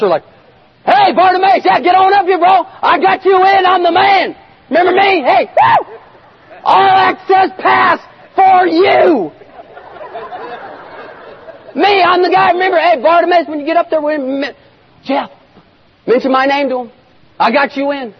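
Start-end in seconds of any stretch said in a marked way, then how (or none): 0.9-9.3 high, hollering hype-man; over-excited shouted bragging; fawning new buddy. Acted. Crowd suddenly fawning over Bartimaeus. 11.7-18.1 high-pitched pleading name-dropper; eager, high hanger-on voice; fawning buddy. Acted. Same fawning buddy angling for favour.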